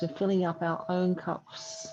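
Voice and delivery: ordinary talk, nothing marked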